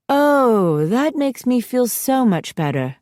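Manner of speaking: sarcastic. A narrator's reading. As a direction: let the sarcasm drip. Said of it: "she says sarcastically".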